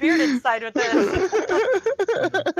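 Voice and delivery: loud, slow, laughing through it. Real, not acted. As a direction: crack up while saying it.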